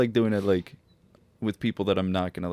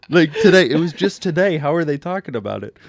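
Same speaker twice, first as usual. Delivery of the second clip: loud, high, disbelieving outburst; incredulous old lady; 9 semitones higher, 10 dB louder than usual. Retold or imitated. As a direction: play the shocked old woman, loud and high. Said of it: "And she's like".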